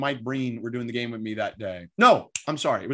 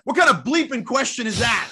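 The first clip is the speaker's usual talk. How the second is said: high-pitched, playful retold outrage; mock indignation; 9 semitones higher, 4 dB louder than usual. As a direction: throw it out as an incredulous jab, half laughing. Retold coach's mock-indignant comeback.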